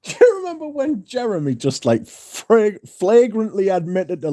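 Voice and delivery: high-pitched, laughing through the words; real laughter breaking into the speech. Real, not acted. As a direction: struggle to talk while cracking up. Cracking up while recalling the story.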